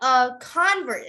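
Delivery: "convert" as CON-vert; stress on first syllable. Shown as a demonstration. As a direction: punch the start of "convert". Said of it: "if it's a noun".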